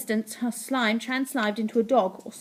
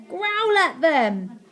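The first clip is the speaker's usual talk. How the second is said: high, loud, drawn-out order; urgent command; 5 semitones higher, 5 dB louder, much slower than usual. A narrator's reading. Boy orders his dog friend to scare cats, urgent. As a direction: urge it on, stretching the words.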